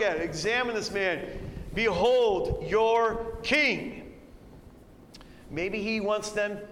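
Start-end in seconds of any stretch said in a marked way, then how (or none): 1.7-4.0 Pilate presenting. Acted. Pilate presents Jesus to crowd.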